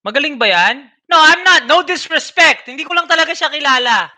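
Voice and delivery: high, loud, hurried backpedal; blurting that he means no disrespect; flustered protest. Retold or imitated. Streamer backpedaling on air, high and loud. Reenacting his live reaction.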